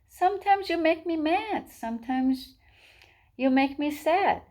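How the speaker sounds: high-pitched chick echoing Mommy; little chick. A narrator's reading. Chick echoes his mother's words.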